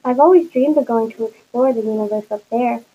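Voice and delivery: usual speaking voice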